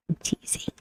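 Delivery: teasing whisper, barely voiced. Real, not acted. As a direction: whisper the joke aside. Playful aside after joking about attorney.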